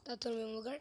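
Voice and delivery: ordinary delivery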